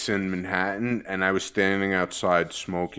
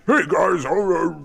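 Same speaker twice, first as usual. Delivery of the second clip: a lightly raised, higher mock voice of a friend; imitating Timmy's impression; 7 semitones higher, slower than usual. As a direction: toss off a quick copy of his friend's bit. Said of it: "do your impersonation of me".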